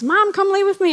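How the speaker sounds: put-on high childlike pleading voice; little daughter's plea. Daughter at bedtime asking mom to lie down, high childlike plea. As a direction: plead like a small girl at bedtime.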